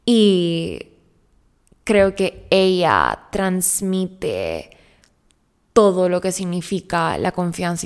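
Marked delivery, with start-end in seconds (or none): none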